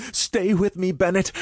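high, pleading beg, a hint of a sob; begging with a sob. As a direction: beg him to stay, holding back tears. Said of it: "I begged as a sob caught in my throat".

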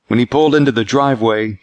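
ordinary delivery, nothing marked

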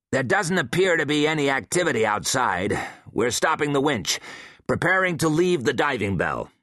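lightly voiced woman reporting on the radio; crisp radio report, businesslike; Hensley on radio. A narrator's reading.

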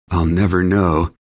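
ordinary delivery, nothing marked

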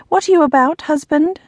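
high, buttery smooth suspicious question; smooth suspicion. A narrator's reading. Lady Macan questioning her husband's plans, smoothly suspicious. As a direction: sweetly probe with rising suspicion. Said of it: "her voice laced buttery smooth with suspicion".

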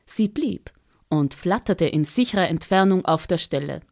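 plain reading voice

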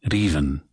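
raven with a tapped R and close E vowel; Scottish raven. Shown as a demonstration. The coach demonstrates raven in Scottish. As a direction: say raven as reevin with a flapped R. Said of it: "You heard me say raven".

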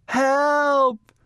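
high, loud cry for rescue; calling for help. A narrator's reading. Missus Watson stuck upstairs, calling for help. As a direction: call out high and urgent. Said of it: "Missus Watson called again".

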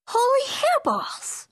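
exclamation. Acted. Kitten shocked by his reflection in the mirror, high amazed cry. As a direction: gasp it out in amazed delight. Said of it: "exclaimed Skippy John Jones".

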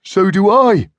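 high, loud eager puppy voice; puppy Puzzle barking along with its friend. Acted. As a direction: bark in keen agreement. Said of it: "barked Puzzle".

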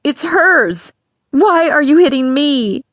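put-on a hurt girl screaming accusation; screaming rage. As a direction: scream it at the father who hits her. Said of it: "Vera screamed out her rage".

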